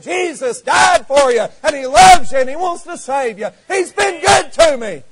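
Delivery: high, loud and slow pulpit shout; preacher's cry. Retold or imitated. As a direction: proclaim it flat-footed from the pulpit. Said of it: "and saying".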